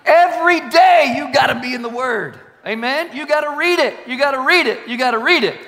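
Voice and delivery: high, shouted preaching; emphatic exhortation. Real, not acted. Preacher urging the congregation into daily reading, emphatic exhortation.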